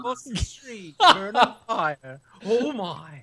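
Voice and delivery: put-on high, slow old professor voice; Professor Farnsworth impression. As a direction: senile scientist musing. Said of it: "That was fantastic".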